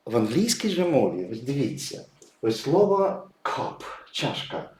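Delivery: normal talking voice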